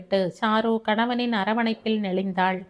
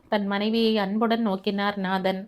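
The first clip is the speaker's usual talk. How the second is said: plain reading voice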